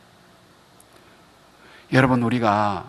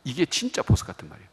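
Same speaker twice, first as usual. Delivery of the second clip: normal talking voice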